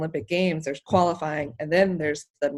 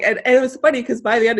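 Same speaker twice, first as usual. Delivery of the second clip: ordinary delivery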